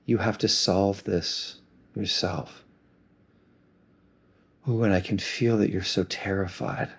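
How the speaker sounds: put-on gently mirror the pain back to a hurt child; a loving sponsor consoling someone who is hurting; compassionate. Shown as a demonstration. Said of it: "I start with a lot of compassion".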